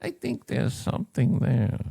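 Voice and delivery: slow, sinister mad scientist; evil scientist voice. Shown as a demonstration. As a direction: purr it like a scheming villain. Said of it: "evil scientist voice".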